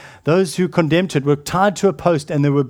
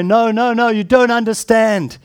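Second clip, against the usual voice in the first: raised, insistent no no no; emphatic protest; 7 semitones higher, 5 dB louder than usual. Pretend. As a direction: protest loudly, pleading to be understood.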